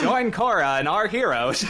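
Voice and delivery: loud words through laughter; barely holding it together laughing. Real, not acted. Laughing while trying the voice.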